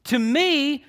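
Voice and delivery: "to me" high and drawn out with weight; emphatic. Retold or imitated. Preacher repeating the emphatic opening of the verse, emphatic. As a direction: press hard on "to me".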